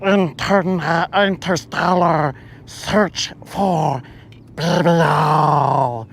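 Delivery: slow, deliberate alien announcement. Retold or imitated. Alien explaining its mission, slow alien voice. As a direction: spell it out like a visitor from space. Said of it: "And it's like".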